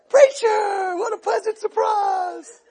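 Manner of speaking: high, drawn-out gushing greeting; put-on sing-song welcome; mock delight. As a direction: imitate hosts faking a happy welcome. Householders fake delight at the door.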